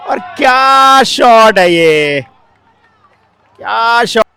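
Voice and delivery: loud, slow shouted call; excited cricket commentary. Real, not acted. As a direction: roar out the great shot. Shouting at a great shot.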